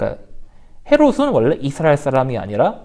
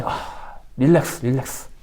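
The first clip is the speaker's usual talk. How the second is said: calming himself; 6 semitones lower, 6 dB quieter, slower than usual. Acted. Herod calms himself down.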